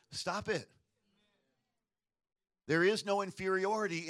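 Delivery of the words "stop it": usual speaking voice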